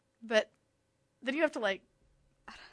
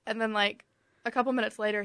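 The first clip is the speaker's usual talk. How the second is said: normal talking voice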